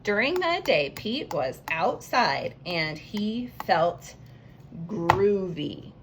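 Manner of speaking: slow, stretched reading, "During the day" lifted; slow reread. Shown as a demonstration. Teacher rereads her sentence slowly to the class. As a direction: read it back word by word.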